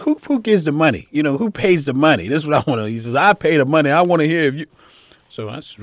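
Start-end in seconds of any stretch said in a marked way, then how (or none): 0.0-2.3 loud, higher indignant question; imitated heated argument. Retold argument over who pays. 3.1-4.7 loud, high assertion of authority; imitated heated insistence. Retold boss insists in argument.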